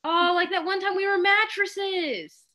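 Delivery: light up remembering it; excited recall. Real, not acted.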